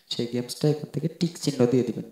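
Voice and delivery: ordinary delivery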